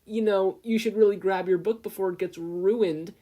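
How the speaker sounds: high, loud taunting suggestion; mock helpfulness, loud. A narrator's reading. Crony mockingly telling Izuku to fetch his book. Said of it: "Tasaki suggested".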